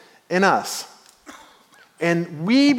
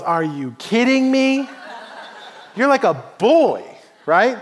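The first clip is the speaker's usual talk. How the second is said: higher, mildly mocking incredulity; dismissive disbelief; 4 semitones higher than usual. King Saul to young David who wants to fight, dismissive. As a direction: lightly act out scornful disbelief. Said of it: "He's ready to dismiss him".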